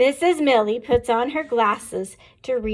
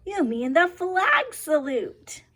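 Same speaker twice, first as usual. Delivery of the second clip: put-on kids' voice, higher and slow on the correction; amused children; 6 semitones higher, slower than usual. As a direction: tease the teacher like giggling pupils.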